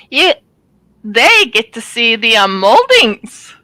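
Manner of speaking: slow, stumbling take on moldings; garbled first take. Real, not acted. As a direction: trip over the words, drawing out moldings. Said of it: "That didn't sound right".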